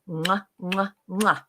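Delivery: smacking kiss sounds; blown kisses. Acted. Blowing kisses to the viewers. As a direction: blow kisses to the audience. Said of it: "I love you all".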